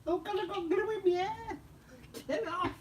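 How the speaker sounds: high-pitched real dismay. Real, not acted. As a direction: squeal about the glue in your hair. Finds glue in her hair.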